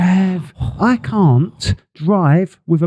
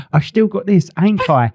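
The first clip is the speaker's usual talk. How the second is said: lightly voiced higher little-kid voice; a hint of a cheeky Cockney child; film Cockney kid; 6 semitones higher than usual. Quoting the Bedknobs and Broomsticks boy, Cockney kid. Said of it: "I turned into that little kid at the end of Bed Knobs and Broomsticks".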